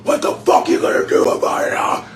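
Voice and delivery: imitating a shouting wrestler's challenge; WWE wrestler rant. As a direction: growl it out like a wrestler picking a fight. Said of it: "it's almost like he's like a wrestler".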